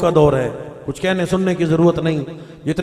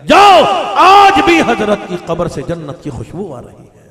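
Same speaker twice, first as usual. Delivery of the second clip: shouted out, much higher and louder; preaching shout; 4 semitones higher, 6 dB louder than usual. Real, not acted. Preacher proclaiming to the gathering, loud shout. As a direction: call it out to the crowd with full force. Preacher bursts out repeating line.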